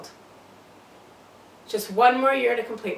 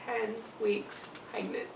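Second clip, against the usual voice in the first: slow, much softer; stunned hush; 10 dB quieter, slower than usual. Acted. Patient takes in her pregnancy news, stunned and quiet. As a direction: let each word fall softly and slowly. Repeats the doctor's shocking news.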